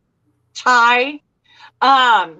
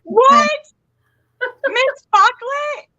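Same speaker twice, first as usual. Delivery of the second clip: mock outrage; 12 semitones higher, slower than usual. Mock outrage at hating movie.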